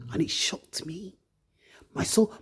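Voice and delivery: hushed whisper, barely voiced. Real, not acted. Telling viewers what shocked her, in a whisper. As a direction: whisper it as if still shaken. Recalling friend's news.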